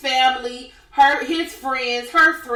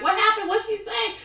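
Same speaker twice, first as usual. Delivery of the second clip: worried friend; 10 semitones higher than usual. Retold or imitated. Margaret running after, worried.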